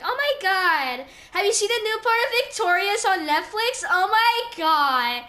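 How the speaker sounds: mock the girls fangirling over a show; gushing girls. Mocking classmates obsessed with a show, hammed-up gushing. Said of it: "they'd be like".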